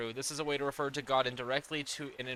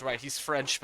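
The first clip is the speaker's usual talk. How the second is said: ordinary talk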